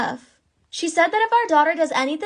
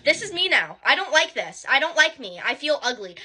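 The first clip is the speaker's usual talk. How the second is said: normal talking voice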